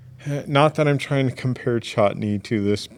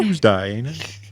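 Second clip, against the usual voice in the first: punchy British accent with lifted tag; mock British accent; 3 semitones higher, 4 dB louder than usual. Shown as a demonstration. Repeating the phrase for friends, mock British accent. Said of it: "It's British Tuesday".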